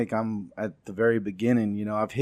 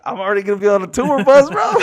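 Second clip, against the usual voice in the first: high, loud, fast burst of retold excitement; excited boast; 11 semitones higher, 10 dB louder, faster than usual. Comic retelling his reaction to a tour offer, excited. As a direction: blurt it out loud and high, thrilled.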